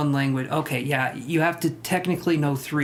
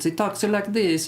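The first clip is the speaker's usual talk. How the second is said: higher, slow mock Italian accent; 5 semitones higher, slower than usual. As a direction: talk like a cartoon Italian. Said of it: "the stereotypical Italian guy".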